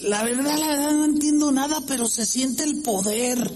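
high, fervent voice of a believer; excited believer. Retold or imitated. Pastor imitating an excited believer at a crusade. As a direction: gush about feeling the power, pitched high. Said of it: "Y dice".

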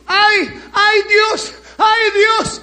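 high, loud frightened wail of ay Dios; panicked cry to God. Retold or imitated. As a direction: cry out like a woman feeling death coming. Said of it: "y dijo".

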